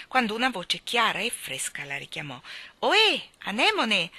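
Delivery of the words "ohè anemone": high, loud hail across the water; calling out. Acted. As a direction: call her back from afar. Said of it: "gridava la voce". (richiamo ad alta voce)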